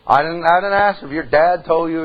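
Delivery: ordinary talk, nothing marked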